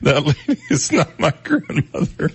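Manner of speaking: cracking up while reading the quote; laughing. Real, not acted. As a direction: try to read on through helpless laughter. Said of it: "I shouldn't be laughing".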